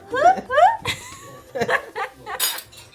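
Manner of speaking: bright burst of laughter; loud high laugh. Real, not acted. Laughs after singing.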